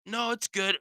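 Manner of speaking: high strained reassurance, then a grunt; imitation of an achy grunter; grunt at sentence end. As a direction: brush it off while sounding in pain. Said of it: "there's always, like, a grunt".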